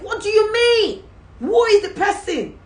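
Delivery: high, slowed incredulous boss; demanding boss. Retold or imitated. The boss demands who is being sacked. Boss voiced in retold story.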